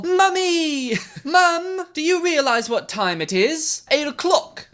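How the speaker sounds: very high hammed-up British; shrieky-high mock-British whine; British accent. Teen asks her mummy the time, high mock-British accent. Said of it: "Do I have to do a British accent".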